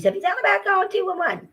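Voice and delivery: high, fast mocking voice of the supervisor. Mocking the supervisor's advice in a high fast voice. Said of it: "he was like".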